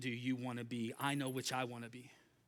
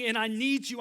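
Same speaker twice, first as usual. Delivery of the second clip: crying out; 9 semitones higher, 9 dB louder than usual. Acted. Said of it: "crying out to God".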